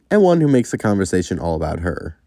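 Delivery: ordinary delivery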